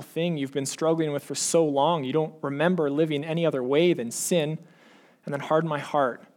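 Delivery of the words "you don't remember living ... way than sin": ordinary delivery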